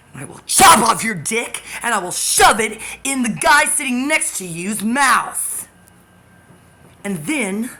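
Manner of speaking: loud, high, raging yell; screamed threat. Real, not acted. Threatening the stalker, raging loud scream.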